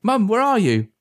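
high, urgent call. A narrator's reading. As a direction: call for mum, pitched well up. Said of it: "he called out".

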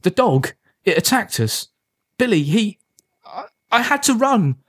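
put-on high-pitched, halting, rattled boy; shaken. A narrator's reading. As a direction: stammer it out, pitched well up. Shaken boy tells mother of attack.

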